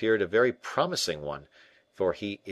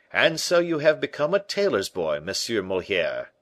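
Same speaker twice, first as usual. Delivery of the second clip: teasing call; 3 semitones higher, 7 dB louder than usual. Acted. D'Artagnan teasing hidden Moliere.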